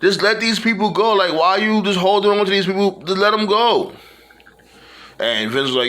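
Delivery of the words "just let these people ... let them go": imitating an exasperated plea, pitched up; mock pleading, raised high. Triple H urging Vince to release the wrestlers, pitched-up mock pleading. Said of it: "Triple H basically said".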